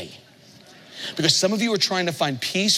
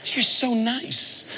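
slow fond praise; 5 semitones higher, much slower than usual. Retold or imitated. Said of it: "I'm just going like".

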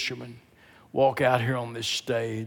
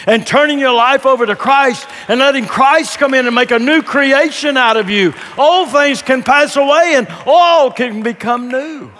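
loud, high-pitched preacher's proclamation; full-force preaching; 13 semitones higher, 13 dB louder than usual. Real, not acted. Preacher urging people to turn to Christ, full force.

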